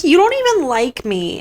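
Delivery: high put-on whimper, wounded; Helena's hurt whine. Helena crying to Kane after kiss, high mock whimper. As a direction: play her pulling away near tears, mock hurt. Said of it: "She's like".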